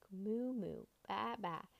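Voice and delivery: usual speaking voice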